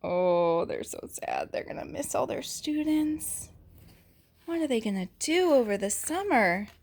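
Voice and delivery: ordinary delivery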